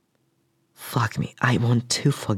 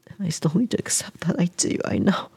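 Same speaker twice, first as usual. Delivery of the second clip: tearful whispered confession; crying whisper; 6 semitones higher than usual. Acted. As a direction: whisper through held-back tears. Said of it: "I'm crying".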